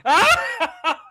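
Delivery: high, loud hammed-up cry of shock; mock gasp of shock. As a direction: ham up a theatrical shocked cry. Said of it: "we're all supposed to go".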